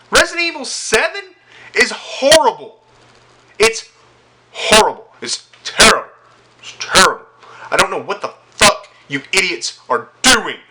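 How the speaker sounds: high, loud, outraged yelling; furious shout. Real, not acted. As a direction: blast it out in full fury. Furious rant at Capcom.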